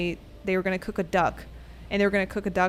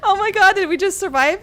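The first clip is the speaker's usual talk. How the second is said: high, louder amazed cry; excited disbelief; 10 semitones higher, 8 dB louder than usual. Real, not acted. Streamer just survived the gank, high loud amazement. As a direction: shout out the relief.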